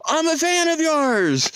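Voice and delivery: high, stretched-out mock gush; imitated excited fan. Service vehicle guy learning the hiker's name, imitated excitement. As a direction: imitate a thrilled fan, slow and high-pitched. Acts out vehicle driver's delighted reaction.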